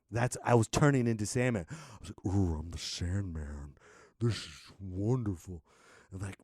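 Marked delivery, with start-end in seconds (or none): 2.3-5.6 lower, slow and blissed-out; slow, lower, dazed delight; dreamy, stoned. Retold or imitated. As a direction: drift through it like a happy stoner. High, pretending to be Sandman.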